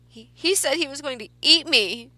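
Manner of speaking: put-on high, loud tearful child, a touch of sobbing; a frightened girl blurting through tears; blubbering. A narrator's reading. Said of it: "Clara blubbered".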